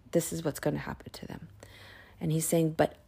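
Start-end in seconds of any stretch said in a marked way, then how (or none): 0.1-1.5 fast ominous whisper; unvoiced hushed threat. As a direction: whisper the warning.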